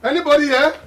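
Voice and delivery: loud, high call across the house; high, loud shout into the empty house. Acted. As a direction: shout it so someone far off hears. Father calling, louder each time.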